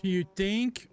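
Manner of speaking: dry, mocking comeback; sarcastic. Real, not acted. Retort to obvious game line.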